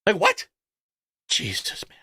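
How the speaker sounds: usual speaking voice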